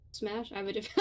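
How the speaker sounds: ordinary talk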